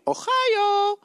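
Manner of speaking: high, called-out fake Ohio accent; mock Ohio accent. Shown as a demonstration. Guest proving his origins to the host, high mock Ohio accent. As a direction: belt it out in a supposedly Ohio accent. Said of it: "It's my Ohio voice".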